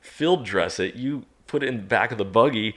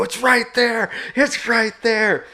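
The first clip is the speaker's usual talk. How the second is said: high urgent yell, slower on the repeat; excited shout; 14 semitones higher, 6 dB louder, slower than usual. Retold or imitated. Pointing out the bear to buddies, high urgent yell. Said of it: "And I go".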